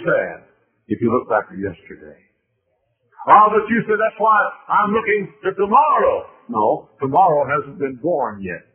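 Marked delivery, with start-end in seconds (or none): 3.3-6.3 imagined objector. Pretend.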